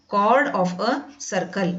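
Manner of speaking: chord of a circle read out word by word, very slowly; slow clear dictation. Shown as a demonstration. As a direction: spell it out slowly for the class. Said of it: "pronunciation cord बोलते हैं". (बहुत धीरे, साफ़-साफ़ बोलकर)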